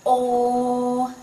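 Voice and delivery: "O" held long on one level pitch; level vowel demonstration. Shown as a demonstration. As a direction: sustain "O" evenly for a learner. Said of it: "the single vowel is".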